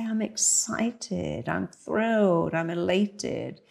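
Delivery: slow, deliberate declarations; slow, relishing self-talk. Shown as a demonstration. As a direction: lean into each word slowly.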